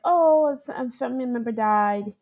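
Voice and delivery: a mock tech lead, pitched high and loud; imitating the tech lead. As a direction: blurt it out as the caught-off-guard tech lead. Tech lead's reply, imitated.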